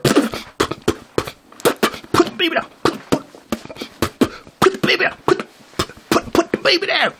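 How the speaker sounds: sputtering squeaky high mimicry; spitting nag. Mocking the woman spitting her demand, squeaky sputtering nag. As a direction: spit out the p's in a fast, tiny high voice. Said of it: "I thought she was spitting on me".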